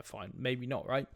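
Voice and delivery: ordinary delivery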